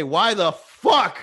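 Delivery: "fuck" drawn out, loud and punched; stress on "fuck". Real, not acted. Comic reacting to Brickell prices, "fuck" heavily stressed and drawn out. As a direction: hit "fuck" hard and stretch it. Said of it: "I put emphasis on the word".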